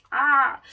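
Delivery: sudden loud high yelp of dismay; high, loud self-mocking shriek. Real, not acted. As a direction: let out a sharp embarrassed cry. Dismay at forgotten mic.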